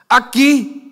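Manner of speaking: the fake saint insisting proudly; mock pious answer. (respuesta piadosa fingida, aguda y fuerte)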